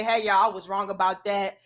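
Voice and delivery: ordinary talk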